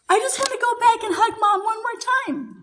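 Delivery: high-pitched, mildly pleading retelling; retold plea. Wife asking husband to turn back, retold plea. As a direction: plead lightly, voice lifted well up.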